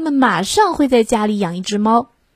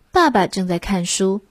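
ordinary delivery, nothing marked

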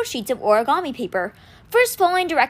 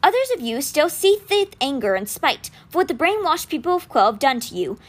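ordinary delivery, nothing marked

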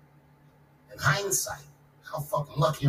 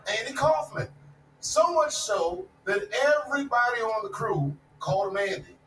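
usual speaking voice

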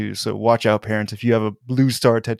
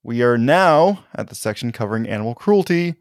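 ordinary delivery

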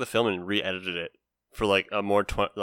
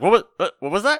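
higher-pitched startled question; mock confusion; 9 semitones higher, 5 dB louder than usual. Viewer caught off guard by sudden dialogue, confused. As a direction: stumble over it like you missed the line.

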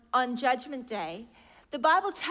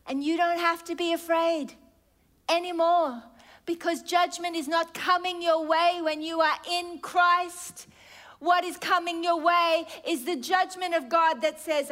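rising preaching; 5 semitones higher, 4 dB louder than usual. Acted. Preaching at full force.

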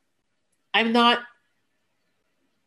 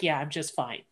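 curt flat reply; 5 semitones lower, 9 dB quieter than usual. Retold or imitated. Curt reply to nosy nurse.

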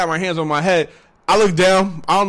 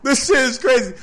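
louder, higher, through laughter; laughing; 7 semitones higher, 4 dB louder than usual. Real, not acted. Storyteller cracking up over the police raid, laughing. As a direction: let the laugh ride under the words.

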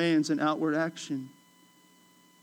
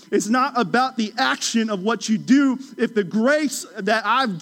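impassioned Jesus; 5 semitones higher, 5 dB louder than usual. Retold or imitated. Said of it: "and Jesus goes".

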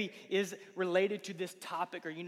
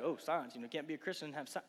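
mocking skeptic; 4 semitones lower, 6 dB quieter than usual. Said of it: "everybody's like".

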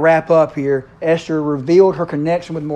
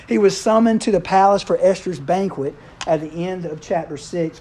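ordinary delivery

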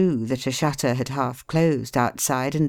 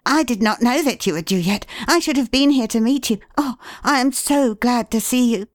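put-on high, quick, eager boy's voice; 9 semitones higher, 4 dB louder than usual. A narrator's reading. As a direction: gush like a happy lad. Eager boy greets father.

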